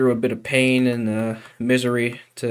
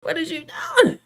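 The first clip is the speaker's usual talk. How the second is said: meme voice. Pretend. Repeats meme line in put-on voice.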